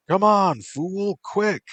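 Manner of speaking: high-pitched barked urging; urgent soldier. A narrator's reading. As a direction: hurry them along, voice up high. Soldier urges them on.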